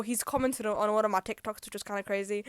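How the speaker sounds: ordinary talk, nothing marked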